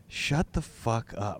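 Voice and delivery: slow, low-key muttered put-down; understated acted exasperation; mock fed-up. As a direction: voice the room's silent irritation, slowly and softly. Voices the fed-up friends around him.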